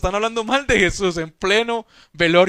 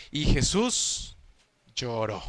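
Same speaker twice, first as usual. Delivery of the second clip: muy lento y muy suave, casi apagado; leído con pena; 7 semitones lower, 8 dB quieter, much slower than usual. Acted. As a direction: deja caer «lloró» en voz baja, con tristeza contenida. Lectura solemne del versículo. (lectura lenta y dolida, mucho más suave)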